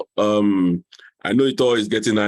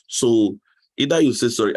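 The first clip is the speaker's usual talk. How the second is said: ordinary talk, nothing marked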